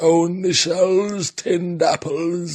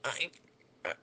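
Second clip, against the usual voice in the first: quiet confession; 5 semitones lower, 11 dB quieter, faster than usual. Acted. Embarrassed confession.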